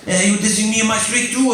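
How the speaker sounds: high-pitched mock threat; imitated angry Latin American man; angry street tough. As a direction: challenge him in a high put-on voice. Angry man turns gun on him.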